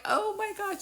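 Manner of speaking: high-pitched astonished exclamation; high, stunned reaction, retold; retold amazement. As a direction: act out being floored by a coincidence. Retold reaction to the meaningful song.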